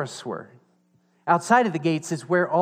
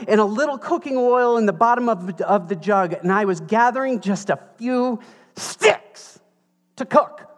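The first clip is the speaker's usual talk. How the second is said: exasperated widow; 9 semitones higher, 6 dB louder than usual. Retold or imitated. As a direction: plead your poverty, high and indignant. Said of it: "And look what happens".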